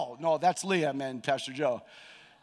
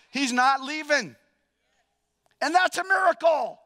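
higher and louder, punching not; building joyful emphasis; 9 semitones higher, 5 dB louder than usual. Real, not acted. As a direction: lift it, rising with joy on "He's not leaving". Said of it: "repeated louder, building".

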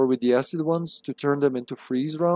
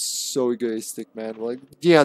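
ordinary delivery

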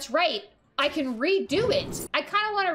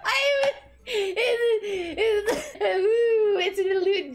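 laughing; 2 semitones higher, much slower than usual. Real, not acted. Cracking up at the misplay.

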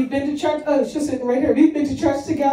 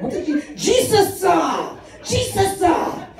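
preacher impression; 7 semitones higher, slower than usual. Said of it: "and he was, I said".

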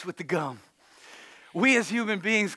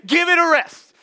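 high, louder mock snap; teasing jab at spouse; 8 semitones higher, 6 dB louder than usual. Shown as a demonstration. Preacher has the congregation tease their spouse, mock snap. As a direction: fire it at your spouse, half joking. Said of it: "Look at your spouse and say".

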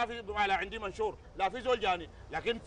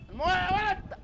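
calling out; 7 semitones higher, slower than usual. Acted. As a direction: shout for the boy to bring water. Shopkeeper calls the boy for water. (نداء عالي للولد يجيب الموية)

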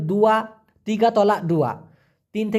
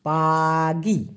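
slow demo; 3 semitones lower, much slower than usual. Shown as a demonstration. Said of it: "বলা হয়".

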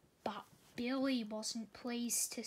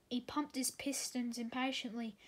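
plain reading voice

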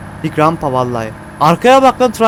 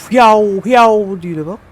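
jet imitation; 3 semitones higher, 3 dB louder, much slower than usual. Imitating the jet's sound.

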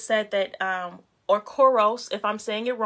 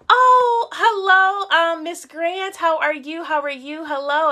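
high, loud delighted hello; light up greeting a surprise viewer; excited greeting; 7 semitones higher, 8 dB louder, slower than usual. Real, not acted. Greeting Miss Grant joining the live show, delighted.

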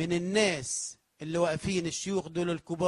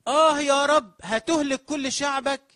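loud, high, slowed cry to God; crying plea; 9 semitones higher, 11 dB louder, slower than usual. Acted. Prophet begging the Lord to spare his people, loud crying plea. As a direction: plead desperately for the people.

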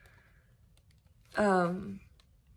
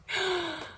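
touched gasp; 9 semitones higher, 4 dB quieter than usual. Real, not acted. Gasp over gift.

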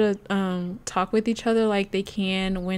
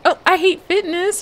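much higher and louder whiny complaint; voicing Dirk grumbling; 9 semitones higher, 8 dB louder than usual. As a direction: play the sim fed up with exercise. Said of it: "From disliking fitness".

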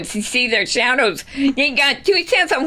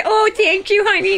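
a lightly raised, sweet wife voice; wife's thank-you; 7 semitones higher than usual. Acted. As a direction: coo it like a pleased wife. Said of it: "You know what she's saying?".

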